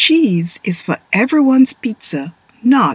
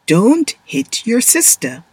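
ordinary delivery

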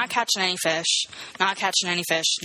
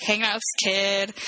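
usual speaking voice

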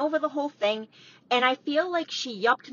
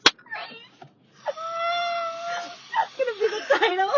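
laughing. Real, not acted. Laughing fit after the joke.

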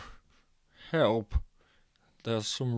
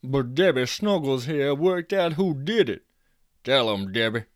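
louder proud announcement; announcing; 3 semitones higher, 4 dB louder than usual. A narrator's reading. Jack telling the party guests about Debbie, loud. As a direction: present her to the crowd.

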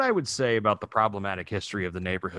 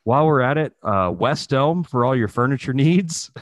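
louder, announcer-style furniture plug; mock ad read; 3 semitones higher, 6 dB louder than usual. Podcast host jokingly plugging a furniture brand, louder ad voice. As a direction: pitch it like a radio spot.

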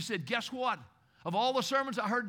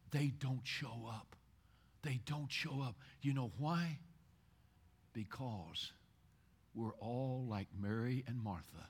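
quiet, lower, tender pulpit voice; intimate soft preaching; 8 semitones lower, 8 dB quieter than usual. Acted. Pastor comforting the congregation that God loves them, softly. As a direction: draw them in gently and softly.

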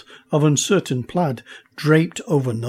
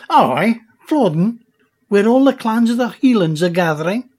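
higher, louder Highland brogue; Scottish accent; 6 semitones higher, 5 dB louder than usual. A narrator's reading. Chieftain tells the girl where the clans gather, Scottish brogue. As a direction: proudly call out like a chieftain.

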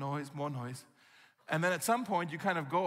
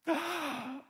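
sharp gasping breath; 11 semitones higher than usual. Retold or imitated. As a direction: gasp as if overcome. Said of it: "that kind of noise".

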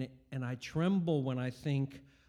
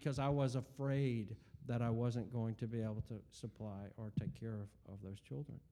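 ordinary talk, nothing marked

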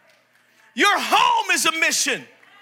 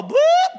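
siren chirp; 4 dB louder than usual. Retold or imitated. Imitating police siren.

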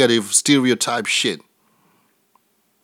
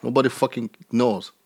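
ordinary talk

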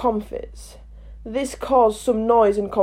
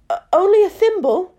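sadly; 10 semitones higher, 4 dB louder than usual. A narrator's reading. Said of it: "said Alice sadly".

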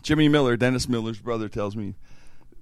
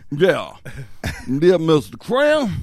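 put-on slow, drawn-out old man, pitched up; old guy voice; 6 semitones higher, slower than usual. Prankster posing as the IRS agent on speaker, old guy voice. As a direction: imitate a prankster playing an old official. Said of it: "He does this old guy voice".

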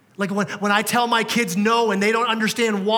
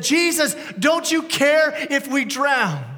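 higher, pleading cry, slowing at the end; imitating a desperate yell; 6 semitones higher, 4 dB louder, slower than usual. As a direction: cry out higher as if the boat is sinking. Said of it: "They yelled at Jesus".

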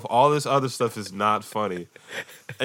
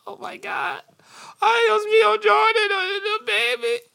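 very high, squeaky mock-weepy voice; hammed-up tearful squeal over a baby; gushing grandparent. Grandparents seeing the new baby, mock-crying gush.

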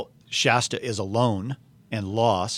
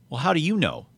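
higher-pitched, lightly voiced boy; a demanding question, pitched up; challenging boy; 7 semitones higher, faster than usual. A narrator's reading. Shasta challenging the lion, higher boyish demand.

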